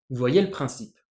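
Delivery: normal talking voice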